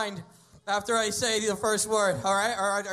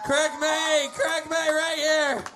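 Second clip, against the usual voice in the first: announcer voice; 3 semitones higher than usual. Acted. Mock show host sign-off.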